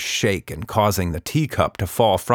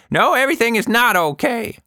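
put-on high, louder, cross old woman's voice; furious; 5 dB louder than usual. A narrator's reading. Anita angrily answering Tilda, high and louder. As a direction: snap back in anger. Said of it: "Miss Anita was furious".

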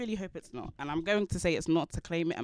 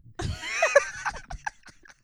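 shrieking burst of laughter; big high laugh; loud laugh; 4 dB louder than usual. Real, not acted. Loud laugh after shout.

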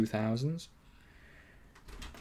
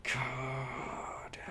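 whispering; 12 dB quieter than usual. Real, not acted. Exasperated aside.

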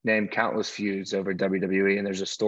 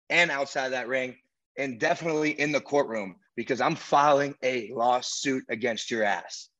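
slow, menacing trash talk; wrestling promo; 3 semitones higher than usual. Acted. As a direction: cut a threatening promo at a rival. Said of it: "Do you think you can do it?".

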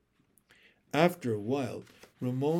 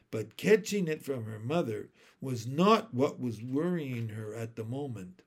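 plain reading voice

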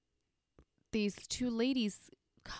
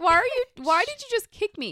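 higher and louder, shocked demand; indignant yell; 11 semitones higher, 9 dB louder than usual. Retold or imitated. Kicked woman shouting back at her attacker, outraged. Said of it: "And I'm like".

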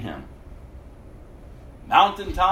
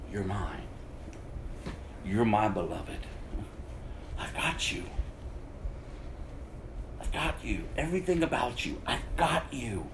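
tender; 5 semitones lower, 9 dB quieter than usual. Pretend. Voicing God's tender assurance.

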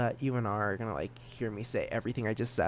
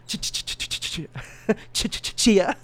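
rapid ticking ch sounds, louder final burst; sprinkler imitation; 6 semitones higher, 4 dB louder than usual. Shown as a demonstration. Podcaster copying own stutter, sprinkler imitation. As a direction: tick like a lawn sprinkler, then pop the end. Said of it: "sound like one of the sprinklers".

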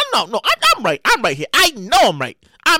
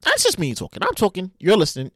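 normal talking voice

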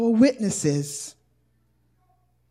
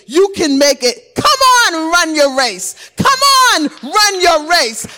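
loud, rousing cheer from the sidelines, much higher at the peaks; shouted encouragement; 5 semitones higher, 6 dB louder than usual. Retold or imitated. Mentor cheering a stumbling runner on: loud rousing shout. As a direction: call out like a mentor urging a fallen runner up. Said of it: "you can hear their voice saying".

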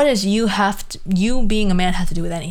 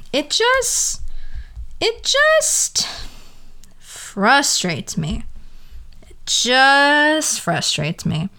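whiny frustration; 6 semitones higher, much slower than usual. Real, not acted. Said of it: "dragged frustration".

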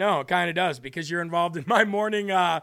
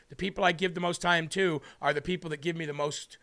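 usual speaking voice

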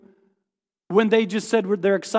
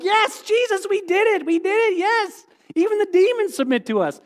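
much higher, thrilled cheering; excited disciples; 12 semitones higher than usual. Retold or imitated. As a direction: celebrate like kids who just won. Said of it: "They come back and are like".

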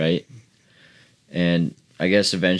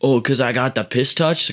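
slightly higher mimicked question; imitated messed-up guy; 5 semitones higher than usual. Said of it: "and he goes".

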